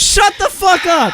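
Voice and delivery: high furious yell; shouting. Real, not acted. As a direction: scream it out at full force. Yelling at the video.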